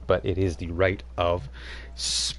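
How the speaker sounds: normal talking voice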